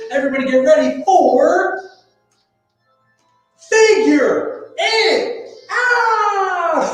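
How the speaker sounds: big, long high announcer shout; game show announcer. Acted. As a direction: stretch it out like a TV host.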